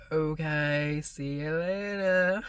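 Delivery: slow, drawn-out mock farewell; sarcastic send-off. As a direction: drag it out with fake acceptance. Sarcastic reply to coworker leaving.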